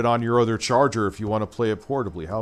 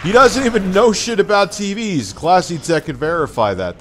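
angry rant; 9 semitones higher, 7 dB louder than usual. Real, not acted. Ranting about the reviewer.

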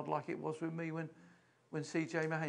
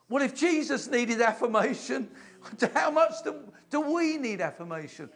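louder and higher, pressing the question home; rising preacher's challenge; 9 semitones higher, 10 dB louder than usual. Real, not acted. Preacher pressing the congregation on their need. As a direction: lift the voice to challenge the room.

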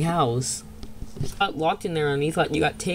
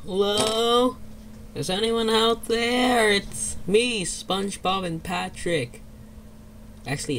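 normal talking voice

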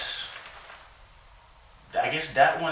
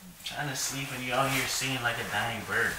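ordinary delivery

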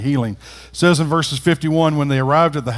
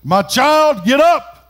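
high, raised voice of authority; loud command; 10 semitones higher, 4 dB louder, much slower than usual. A narrator's reading. Jesus to the dead girl, in a loud commanding voice. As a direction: call out a firm, loud command. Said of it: "said in a loud voice".

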